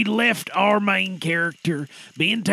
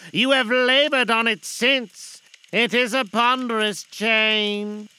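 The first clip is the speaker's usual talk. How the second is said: high, loud, slow ghost voice; unearthly voice; 7 semitones higher, 5 dB louder, slower than usual. Acted. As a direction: drag each word like a chain. Ghost speaks again.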